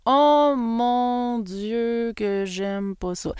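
long drawn-out oh of dread; drawn-out dismayed oh my God; exasperated groan. Real, not acted. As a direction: groan it like you hate this chore. Groaning about disliked task.

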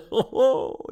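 delighted exclamation, high and loud. A narrator's reading. Professor admiring the jacket to his wife, delighted.

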